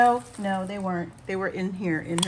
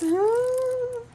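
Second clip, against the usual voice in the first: delighted ooh; 4 dB louder than usual. Real, not acted. Sees yellow gummies.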